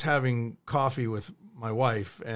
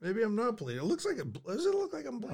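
quiet worry; 2 semitones higher, 11 dB quieter than usual. Real, not acted. Worried over a small wound.

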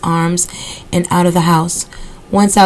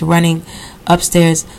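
usual speaking voice

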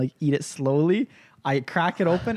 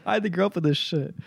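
ordinary talk

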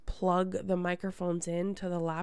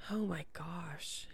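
whispered aside; 8 dB quieter than usual. Real, not acted. Aside while recalling years.

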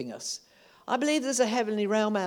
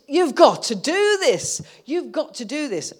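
insistent demand; 8 semitones higher, 8 dB louder than usual. Pretend. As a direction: press God hard on every word. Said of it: "Is it".

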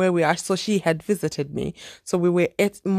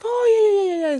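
high eager agreeing; 13 semitones higher, 3 dB louder than usual. Retold or imitated. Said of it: "I was like".

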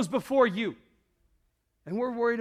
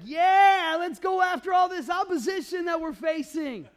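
mock hype; 5 semitones higher, 5 dB louder than usual. Mocking rah-rah Sunday hype.

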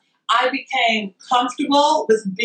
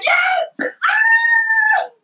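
ecstatic cheer. Retold or imitated. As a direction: scream out the win. Celebrating being picked.

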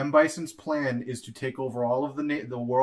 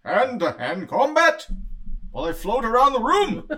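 high mock villain voice; 9 semitones higher, 7 dB louder than usual. Mocking villain line.

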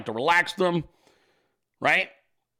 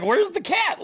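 high pitched-up puzzled where's the cat; mock alarmed question; slower than usual. Streamer retelling searching for his meowing cat, high alarmed. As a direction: ask it way up high, bewildered. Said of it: "Like, I'm like".

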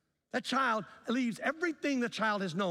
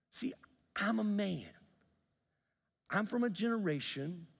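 normal talking voice

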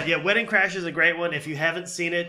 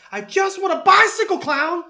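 high, loud demanding yell; sudden high-pitched outburst, imitating a child; yelling kid; 14 semitones higher, 8 dB louder than usual. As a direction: yell it like a fed-up kid in a movie. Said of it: "why are you yelling at me".

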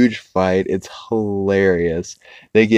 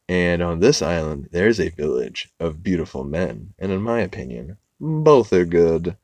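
ordinary delivery

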